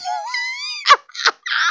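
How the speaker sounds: very high, loud wailing laugh; high shrieking laugh. Real, not acted. Reacts to shocking message.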